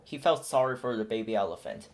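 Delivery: ordinary delivery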